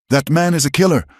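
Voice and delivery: high loud public accusation; commanding shout. A narrator's reading. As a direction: shout it to the whole crowd. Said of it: "Elias shouted to the crowd".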